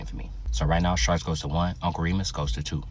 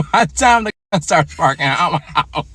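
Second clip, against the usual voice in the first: laughing shout; 12 semitones higher, 11 dB louder than usual. Real, not acted. Joking about a gun fight.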